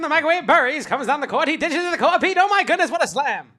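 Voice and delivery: high, loud excited announcer voice; mock play-by-play. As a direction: call the dunk like a hyped broadcaster.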